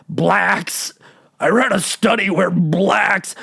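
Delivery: slow, warbly mock of a health secretary; RFK Jr. voice. Comedian mocking the health czar, slow warbly impression. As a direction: imitate the tremor-voiced health czar saying blacks. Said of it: "I'm doing the voice".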